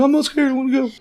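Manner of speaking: a scared boy pretending to be brave; scared kid bravado. Retelling Usopp insisting on coming along, squeaky high.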